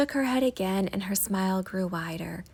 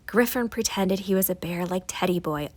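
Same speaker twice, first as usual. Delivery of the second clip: ordinary delivery, nothing marked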